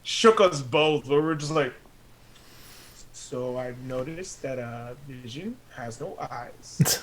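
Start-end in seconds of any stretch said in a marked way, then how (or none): 3.1-7.0 slow, soft deadpan. Retold or imitated. Recalling their shock at Vision's face, slow and soft deadpan. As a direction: state it dryly and slowly.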